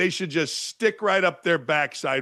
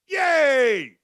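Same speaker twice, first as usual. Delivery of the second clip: mock cheer; 5 semitones higher than usual. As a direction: cheer it like a fake-happy fan. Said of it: "Let's all rejoice".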